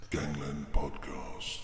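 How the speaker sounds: very low, slow, deep announcer intro. Shown as a demonstration. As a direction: boom it like a movie trailer voice. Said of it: "where I go".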